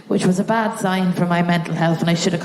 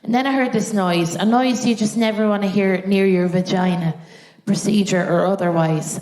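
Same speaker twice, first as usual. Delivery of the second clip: usual speaking voice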